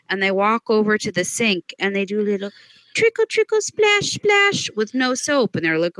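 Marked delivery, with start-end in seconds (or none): 2.9-5.6 high, playful splash noises; mock water sounds. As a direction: squeak out the trickle and splash. Mocking a careless handwash.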